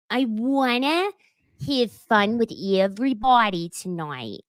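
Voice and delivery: slow, drawn-out mock Australian accent; mock fake Australian accent. [name] copying Gina, hammed-up fake Australian accent. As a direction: imitate [name] putting on a faux Australian accent. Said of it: "trying to talk like Gina".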